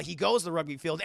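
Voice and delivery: usual speaking voice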